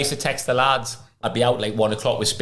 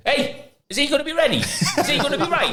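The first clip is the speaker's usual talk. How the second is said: very high mock-furious demand; hammering, imitated. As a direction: impersonate a manager laying into the physios. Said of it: "would have come in hammering them".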